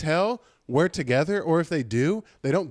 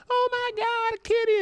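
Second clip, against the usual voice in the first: much higher squeaky mock coo; cooing old lady. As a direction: gush at the cat like a doting old lady. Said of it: "Keep that up. You're getting a golden microphone".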